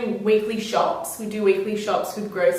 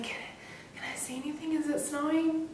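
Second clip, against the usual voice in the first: sleepy hushed question; 6 semitones higher, 11 dB quieter than usual. Retold or imitated. Half asleep at three a.m.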